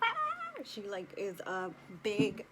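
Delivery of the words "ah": very high, short howl like her dog; imitated dog howl. Imitating her dog howling if left inside, high yelp. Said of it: "she would've been like".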